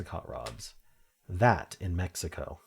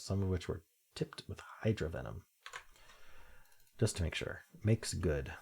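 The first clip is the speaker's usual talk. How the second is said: usual speaking voice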